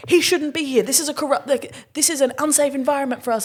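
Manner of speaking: high, earnest complaint; mock passionate protest. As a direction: play it fired-up. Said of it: "and I'm like".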